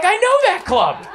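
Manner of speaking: high, eager recognition; excited driver. Retold or imitated. Uber driver telling the comedian he knows the club, excited. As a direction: pitch way up, keen fan energy.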